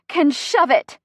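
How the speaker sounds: high, loud, punched fragment; pained. A narrator's reading. Chrissy mid-contraction vents about other moms, pained. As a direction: shove the words out between labor breaths. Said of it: "Deep breath".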